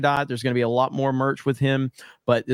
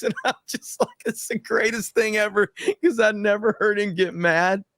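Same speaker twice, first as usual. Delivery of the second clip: high, wheezy amusement; laughing while talking; 6 semitones higher than usual. Real, not acted. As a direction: struggle to get the words out through laughter. Cracking up over manager's rant.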